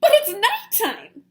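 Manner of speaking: high, loud squeak. Acted. Young bat fearful of flying at night, squeak. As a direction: squeak it out in fear. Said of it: "Stellaluna squeaked".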